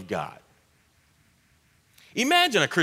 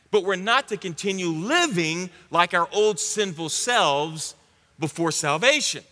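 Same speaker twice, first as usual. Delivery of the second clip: ordinary talk, nothing marked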